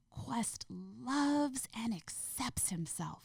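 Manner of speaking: slow, lilting bedtime affirmation; reading to a child. Acted. Mother saying a bedtime affirmation to her son, read-to-a-child inflection. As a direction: draw it out with high, enthusiastic inflection for a little one. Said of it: "same way you'd read a book to a kid".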